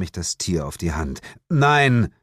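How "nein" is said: impatient. Acted. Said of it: "erwiderte ich ungeduldig".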